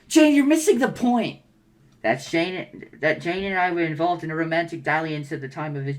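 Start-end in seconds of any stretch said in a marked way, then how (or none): 0.1-1.4 higher, louder frustrated yell. Acted. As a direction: cut her off angrily. John yelling at Jane.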